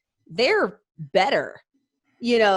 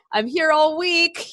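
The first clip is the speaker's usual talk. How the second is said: corny comic sign-off; 6 semitones higher, slower than usual. Retold or imitated. Retelling the movie's hospital jokes, corny comic sign-off. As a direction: deliver it like a hammy stand-up closer.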